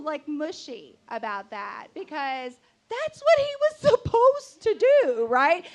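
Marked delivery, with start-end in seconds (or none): 1.9-5.6 loud, high exasperated yell; worked-up, high-pitched protest; indignant outburst. Real, not acted. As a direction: shout your rebuttal at the audience. Indignant rebuttal to mushy listeners.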